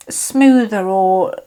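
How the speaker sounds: usual speaking voice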